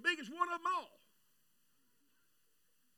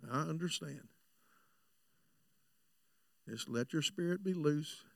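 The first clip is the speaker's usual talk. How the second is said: calm a nervous woman before praying; gentle prayer voice; 9 semitones lower than usual. Acted. Preacher soothing a woman he is about to pray for, gently.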